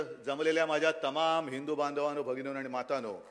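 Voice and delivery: loud public address to crowd; booming rally greeting; rally speech. Real, not acted.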